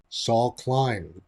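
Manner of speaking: normal talking voice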